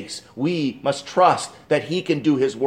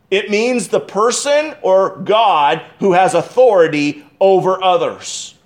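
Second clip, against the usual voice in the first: ordinary talk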